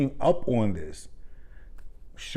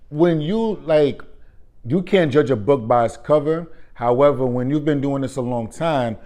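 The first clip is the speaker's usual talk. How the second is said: ordinary talk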